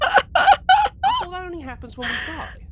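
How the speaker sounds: paranoid alarm. Acted. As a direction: blurt it out in paranoid fright.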